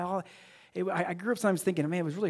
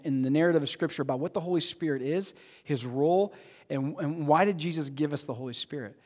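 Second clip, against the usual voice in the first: ordinary talk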